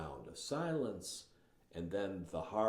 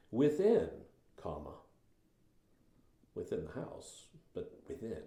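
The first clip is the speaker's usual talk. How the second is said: "Within" set apart by a pause. Shown as a demonstration. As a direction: hold a beat after "within" before going on. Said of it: "the comma forces you to pause".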